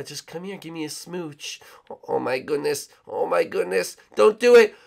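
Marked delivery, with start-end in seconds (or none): 0.0-1.6 much softer coaxing; seductive lure.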